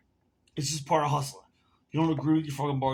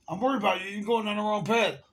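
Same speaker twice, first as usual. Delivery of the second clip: higher-pitched imitation of preachy worry; mock concern; 7 semitones higher than usual. Bartender lecturing a customer about drinking, mock concern.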